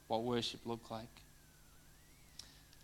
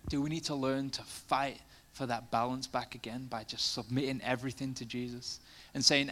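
ordinary talk

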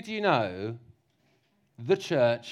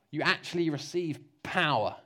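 normal talking voice

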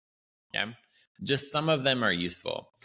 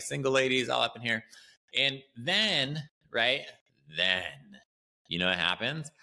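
normal talking voice

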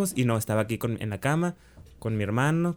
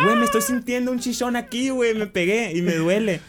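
very high-pitched acted complaint; retold whiny insistence; 10 semitones higher than usual. Telling friends about the bump they deny, high-pitched protest. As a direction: push the voice way up, protesting in disbelief.

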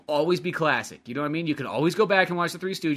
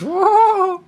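much higher, louder silly whoa; mock whoa; 9 dB louder than usual. Joking with his cohost, high squeaky whoa. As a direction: squeal it like a cartoon.

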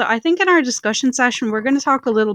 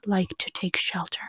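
whispering; 8 dB quieter than usual. Acted. Ghost story, whispered line.

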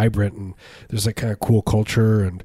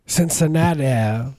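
put-on drawn-out, higher Cincinnati accent; mock Cincinnati accent; 4 semitones higher, slower than usual. Host mocking how Cincinnati locals talk, weird made-up accent. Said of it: "They also have that weird accent there".

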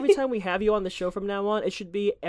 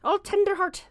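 high, lilting mock Irish accent; 10 semitones higher than usual. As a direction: put on a playful Irish accent, pitched up. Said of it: "That's my Irish accent".

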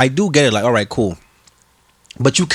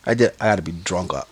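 usual speaking voice

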